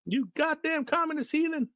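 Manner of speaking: high, screaming sergeant rant; drill sergeant. Retold or imitated. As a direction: scream insults at recruits. Quoting sergeant insults.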